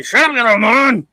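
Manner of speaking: loud, high barked scolding; mock fatherly yell. Imitating a father yelling at his rebellious kids, mock angry. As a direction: snap at the kid like a fed-up dad. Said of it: "your fathers need to ... say".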